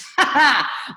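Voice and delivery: a quick amused laugh at the question; real laugh. Real, not acted. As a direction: burst out laughing, knowingly.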